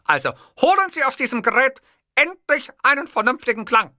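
high, indignant tirade; ranting oratory. A narrator's reading. Boss demanding a sensible ringtone, ranting. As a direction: climb to a heated demand.

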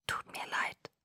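whispered barely audibly. A narrator's reading. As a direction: breathe it without voice. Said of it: "flüsterte er so leise".

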